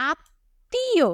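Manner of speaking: আত্মীয় split as "aat... tee-o", high; pronunciation demo. Shown as a demonstration. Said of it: "আমরা কিভাবে উচ্চারণ করি".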